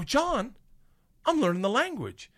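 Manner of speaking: high, thrilled announcement; excited discovery. Retold or imitated. Storyteller replays telling his classmate he's learning, high and excited. Acts out delight at understanding Russian.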